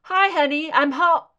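playful hi honey, suddenly cut short; cheery greeting. Acted. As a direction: sing it out like you're walking in the door. Cheery homecoming greeting, then cut off.

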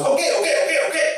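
high, rapid-fire acted giving in; high-pitched repeated placating; exasperated cab driver.

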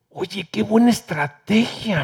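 whispered, slow and secretive. Retold or imitated. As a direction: lean in and whisper it slowly. Doctor confides, retold. (susurro lento y cómplice)

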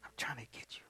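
soft unvoiced whisper. Real, not acted. Preacher whispering a promise to the church, hushed. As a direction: hush it like a secret.

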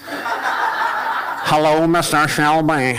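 put-on a Hollywood actor's slow, botched Irish accent; mocking the actor's bad Irish accent for the crowd. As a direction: drag it out like an actor failing at Irish. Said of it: "the cop from Peaky Blinders".

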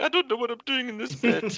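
very high, squeaky comic wail; hammed-up high-pitched panic; mock panic. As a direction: squeal it like a helpless writer. Retelling his panic while writing.

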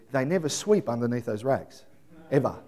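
ordinary delivery

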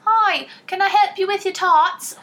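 high, slow, syrupy polite baker; sugary helper. Pretend. Sister imitating a kind British baker, high and sugary. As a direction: coo it like an overly nice contestant.

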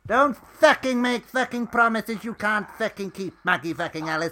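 loud, high rant, every swear word spat out; furious swearing. Acted. Edith screams abuse back at Maggie, furious. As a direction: tear into her at full volume.

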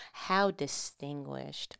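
slow, soft, bored sneer; disdainful. Acted. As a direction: say it while inspecting her nails. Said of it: "looking at her nails like".

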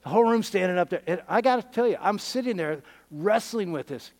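normal talking voice

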